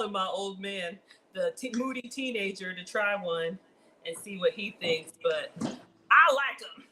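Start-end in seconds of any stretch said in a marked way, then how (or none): 6.1-6.9 laughing. Real, not acted. Laughing while eating.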